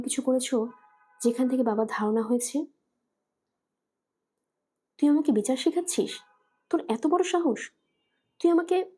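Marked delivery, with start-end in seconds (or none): 5.0-8.9 rising indignant outburst, higher in pitch; mother outraged that her daughter lectures her; angry scolding. Acted. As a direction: snap back at the daughter, pitch climbing.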